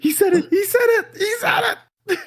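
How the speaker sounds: high squealing shout; shrieking. Real, not acted. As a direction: screech it in gleeful disbelief. Mocking a friend's pick.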